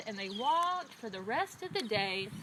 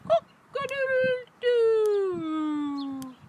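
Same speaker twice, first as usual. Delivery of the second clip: tired rooster crow; 11 semitones higher, 4 dB louder, much slower than usual. A narrator's reading. Rooster exhausted after the long walk, wilting crow. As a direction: crow wearily, deflating at the end. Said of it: "started to sound very tired".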